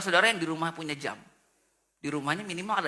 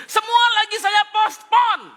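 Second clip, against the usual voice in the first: slow, loud booming reply; mock shout as God; 13 semitones higher, 10 dB louder, slower than usual. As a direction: boom it out as an exasperated God. God voiced, annoyed. (Tuhan menjawab dengan kesal, keras)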